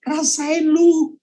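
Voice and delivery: slow, high sneering taunt; gloating devil. Acted. Devil in the corner mocks the believer, slow high sneer. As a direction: savour each word like a gloating devil. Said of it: "Dia berkata".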